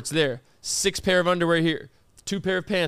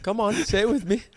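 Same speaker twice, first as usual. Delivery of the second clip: ordinary talk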